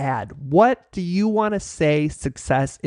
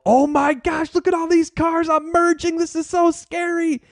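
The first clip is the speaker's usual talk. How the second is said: high-pitched, hammed-up terror; play-acted fright, pitch pinned way up high; mock panic; 13 semitones higher than usual. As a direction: squeal it like a panicking new driver. Retells panicking while first merging.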